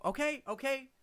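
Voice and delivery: high, tentative plea to come in; caster calling out. Acted. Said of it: "the voice of the, the caster".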